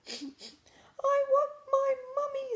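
put-on very high slow wailing baby owl; drawn-out tiny owlet whine, very high. A narrator's reading. Bill wailing for his mother, very high and slow.

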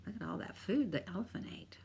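fast unvoiced whisper; quick hushed aside. A narrator's reading. Reader pointing out the eaten food, fast whisper.

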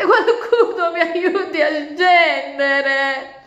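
slow, high, loud sung-out plea; scared wail. Acted. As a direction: wail it down from the tree. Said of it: "gridò spaventata". (lamento spaventato cantilenato)

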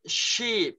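Sheep with a long ee. Shown as a demonstration. Teacher shows student Sheep, long ee. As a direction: hold the ee in Sheep. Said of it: "Kéo dài ra nào".

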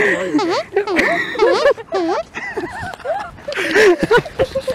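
high rising cartoon jump sound; Mario jump noise. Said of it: "It sounds like Mario jumped".

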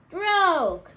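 loud, high rowing call; shouted command. A narrator's reading. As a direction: call the stroke out at full volume.

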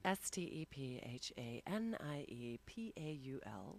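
sultry whispered spelling, soft and slow; sexy voice. Pretend. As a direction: purr the letters out seductively. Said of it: "And she rolls into sexy voice".

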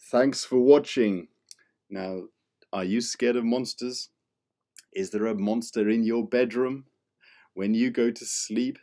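ordinary delivery

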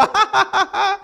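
high, loud gloating cackle; a demon's delighted cackle; devil's gloating laugh. Acted. Devil laughs at the fallen believer, high gloating cackle.

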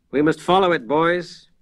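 put-on a touch of a firm old scholar; decisive. The old librarian rallies the frightened rustics, decisive. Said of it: "spoke decisively".

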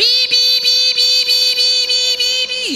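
very high, loud electronic beeps; car beeping. Acted. Car alarm beeping at the boss, very high. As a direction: chirp like a car warning alarm. Imitating the car's warning beep.

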